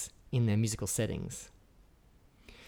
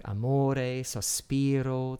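"amore, sospiro" slowly, stretching MO and SPI; stress on second last syllable; much slower than usual. Shown as a demonstration. Lecturer gives examples of piano endings, long second last syllable in "amore, sospiro". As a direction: draw out the middle of "amore" and "sospiro". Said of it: "accent on the second last syllable".

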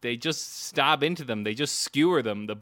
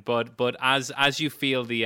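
ordinary talk, nothing marked

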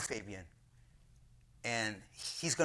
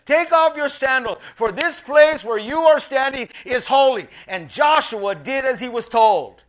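preaching; 11 semitones higher, 15 dB louder than usual. Acted. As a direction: proclaim it from the pulpit. Preaches at full force.

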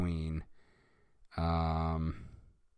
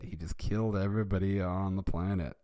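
ordinary delivery

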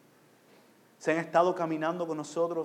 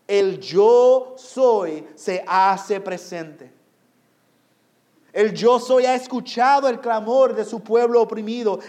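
loud, raised sermon voice building in force; impassioned pulpit proclamation; full-force preaching; 6 semitones higher, 12 dB louder than usual. Real, not acted. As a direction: proclaim deliverance to the congregation at full volume.

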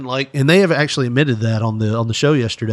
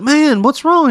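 concerned friend; 4 dB louder, slower than usual. Acted. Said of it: "He's like".

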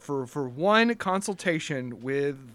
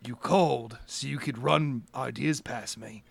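lightly voiced, soft, wary boss; a hint of a guarded businessman, kept low; Mister Holder; 4 semitones lower, 6 dB quieter than usual. Businessman questioning a caller on the phone, quietly wary.

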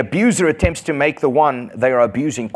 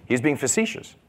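usual speaking voice

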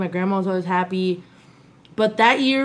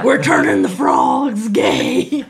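much lower, slow booming shout; mock villain; 13 semitones lower, 4 dB louder, much slower than usual. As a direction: boom it like a cartoon villain.

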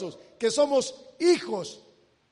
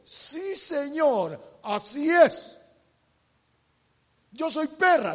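a touch louder and higher, earnest; eager humble reply; 4 semitones higher, 5 dB louder than usual. Acted. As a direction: answer fervently, accepting the insult. La mujer responde a Jesús. (respuesta humilde y fervorosa)

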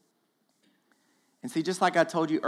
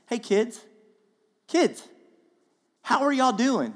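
fatherly call; 5 semitones higher than usual. Retold or imitated. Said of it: "He's like".

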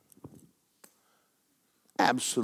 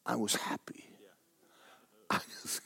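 whispered softly; hushed confession; 12 dB quieter than usual. Real, not acted. Preacher telling congregation his joy, whispered. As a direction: let it slip out in a whisper.

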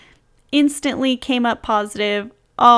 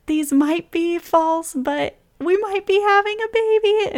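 teary, voice breaking high; 9 semitones higher than usual. Retold or imitated. Telling cousin through tears.

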